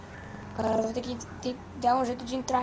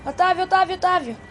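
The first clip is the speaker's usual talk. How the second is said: loud, higher call for a friend; calling out loudly; 5 semitones higher, 6 dB louder than usual. Acted. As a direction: shout the name across a distance.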